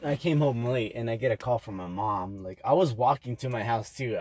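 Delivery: ordinary talk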